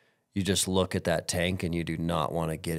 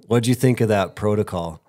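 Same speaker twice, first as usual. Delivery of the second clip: ordinary delivery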